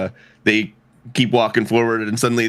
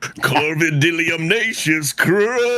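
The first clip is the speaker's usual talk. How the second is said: slower high proclamation; character name flourish; 6 semitones higher than usual. Acted. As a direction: draw out the crow's full name. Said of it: "I kinda wanna hear that one more time".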